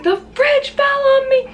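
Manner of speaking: panicked. Retold or imitated. Retold panicked answer to mom.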